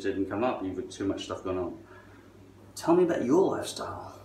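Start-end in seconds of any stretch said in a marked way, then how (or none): 2.7-4.2 high, gushing fake interest; mock eager asker. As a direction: ham up a keen millennial. Mocking eager young listener.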